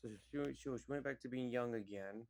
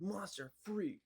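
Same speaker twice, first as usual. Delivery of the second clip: jeering crowd; 8 semitones higher, slower than usual. Retold or imitated.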